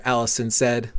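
ordinary delivery

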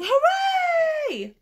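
high, loud joyful cheer; cheering shout. Acted. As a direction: shout it like a crowd. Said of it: "villagers shouting".

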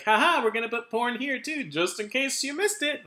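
high, loud mocking store owner; mock the shop's cheeky logic; hammed-up voice of the store owner. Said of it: "just one little corner".

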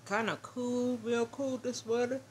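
talking through a yawn; words stretched by a real yawn. Real, not acted. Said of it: "I don't know why I'm yawning".

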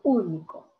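"único" with U stressed; stress on first syllable. Shown as a demonstration. Teacher offering the correct "único", first-syllable stress. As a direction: hit the opening U of "único". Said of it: "la mayor fuerza de voz".

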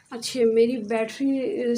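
usual speaking voice

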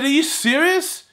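high, slow question in disbelief; retold can't-believe-it question; incredulous. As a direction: lift the pitch and stretch it, incredulous. Retold disbelief at being sent shopping.

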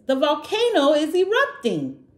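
high, loud panicked shout; frightened kids shouting the alarm; alarmed cry. Acted. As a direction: cry out as the ground starts to shake. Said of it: "cried the friends".

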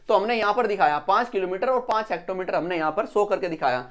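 normal talking voice

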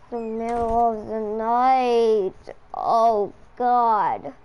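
slow, loud drawn-out moan; hammed-up sleepless exasperation; exasperated groan. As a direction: wail it like a worn-out parent. Pretends being woken by crying.

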